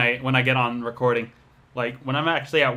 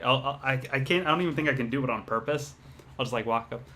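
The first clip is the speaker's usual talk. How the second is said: normal talking voice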